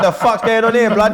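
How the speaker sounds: high, loud mock London accent; mock Cockney. London fan showing how Cockney would sound, mock Cockney. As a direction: exaggerate a London voice for laughs. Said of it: "if you try to do the Cockney thing".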